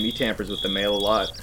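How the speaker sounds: usual speaking voice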